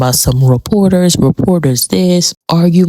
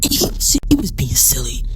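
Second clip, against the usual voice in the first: whispered and softer; softer whispered verdict; hushed aside; 4 semitones lower, 5 dB quieter than usual. Real, not acted. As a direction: drop to a whisper. Hushed repeat.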